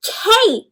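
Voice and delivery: "cake" with a long A, sung up high; "cake" with a long A as its name. Shown as a demonstration. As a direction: lift "cake" with a long clear A. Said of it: "cack, became".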